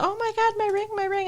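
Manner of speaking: high, soft mock panic; hammed-up high-pitched distress; mock fuss. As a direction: imitate someone making a big deal. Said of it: "she makes a big deal about".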